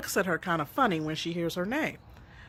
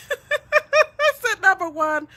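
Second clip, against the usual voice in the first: high, loud laughter spilling into the words; laughing hard as she starts to answer her daughter; 14 semitones higher, 7 dB louder, much slower than usual. Real, not acted. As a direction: laugh through it, caught off guard.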